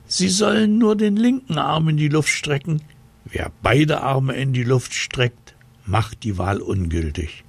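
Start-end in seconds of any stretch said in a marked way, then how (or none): 0.1-2.8 hoch und leicht empört; aufgeregt erklärend. Acted. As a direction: mit erhobener Stimme erklären. Onkel erklärt aufgeregt weiter. (hohe, leicht empörte Erklärung)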